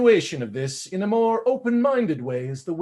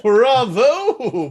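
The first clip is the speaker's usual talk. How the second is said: cheering bravo; 6 semitones higher, 6 dB louder than usual. Acted. Cheering after the recital.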